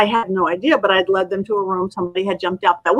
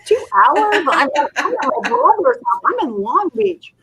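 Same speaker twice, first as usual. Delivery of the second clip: higher, startled and flustered, a voice unlike her own; flustered surprise; 5 semitones higher than usual. Retold or imitated. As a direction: caught off guard, scrambling to get ready. Panicked thoughts about a sudden call.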